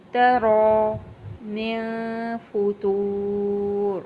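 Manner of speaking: slow, drawn-out tajweed chant; Quranic recitation. Shown as a demonstration. Teacher reciting for the Quran class, slow and drawn out. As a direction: recite it slowly, stretching each vowel.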